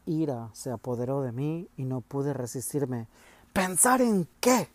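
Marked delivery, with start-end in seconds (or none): 3.5-4.7 loud, higher, slow angry outburst; exclaimed forcefully. A narrator's reading. Boy defying his father at the kitchen table, loud angry outburst. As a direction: burst out at father in rage. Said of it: "exclamé con fuerza".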